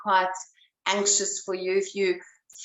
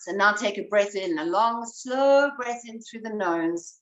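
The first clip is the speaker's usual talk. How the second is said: guided breathing. Acted.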